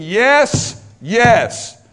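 loud, punched YES, drawn out; emphatic yes. Shown as a demonstration. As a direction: hit each "yes" hard and let it stretch. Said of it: "The words are emphatic".

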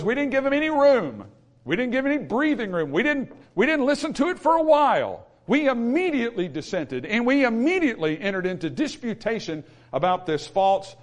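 high, emphatic pulpit delivery; insistent preacher's declaration; forceful preaching. Acted. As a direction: hammer out each denial as a preacher. Preaching Paul's words emphatically.

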